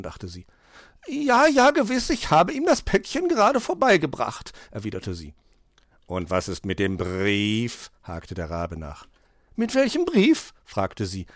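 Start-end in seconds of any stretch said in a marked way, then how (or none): 1.1-4.5 very high, hasty assurance; flustered magpie. Acted. Magpie assures raven the parcel was delivered, flustered. 6.0-7.9 slow, drawn-out question with rolled r; probing raven. Acted. Raven presses magpie about the letter, slow probing. 9.5-10.5 very high, hasty question; startled magpie. Acted. Magpie caught out by raven's question, startled and high.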